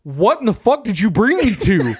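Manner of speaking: high-pitched mock outrage; acting out disbelief at a companion; incredulous demand.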